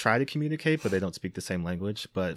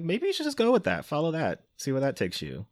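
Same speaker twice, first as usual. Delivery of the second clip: put-on high, gentle wise-old-tree voice, easing back down; high mock Grandmother Willow; 8 semitones higher than usual. Retelling the film, giving Pocahontas advice in a high grandmotherly voice. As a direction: play a lightly voiced kindly grandmother tree. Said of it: "Grandmother was like".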